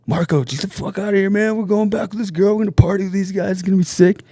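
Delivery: put-on high drunk protest; drunk friend. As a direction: whine like a wasted buddy refusing to leave. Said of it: "And he's like".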